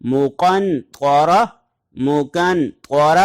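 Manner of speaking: مقن طور drawn out with a heavy ط; measured tajweed reading; slow recitation. Shown as a demonstration. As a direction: recite it slowly, stressing the thick ط. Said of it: "قرآن کریم میں اس کی مثالیں".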